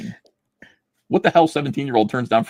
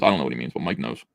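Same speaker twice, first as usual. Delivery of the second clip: normal talking voice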